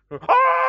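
very high, loud sudden yelp; scream of pain. Acted. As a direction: shriek as if jabbed by a pin. Sits down on the pin.

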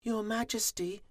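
very high, small, timid voice; meek, frightened high voice; trembling little bee. A narrator's reading. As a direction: squeak it nervously to the queen. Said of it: "he trembled".

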